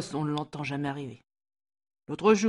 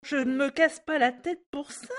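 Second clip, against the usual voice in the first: interrupting; 9 semitones higher than usual. Acted. Maid cutting off Mrs Snell, higher and insistent. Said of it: "interrompit Sandra".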